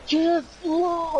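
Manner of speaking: high, stretched-out mock yell; imitated slow-motion battle cry; slow-motion shout. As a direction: drag it out like a slow-motion replay. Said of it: "went into slow motion".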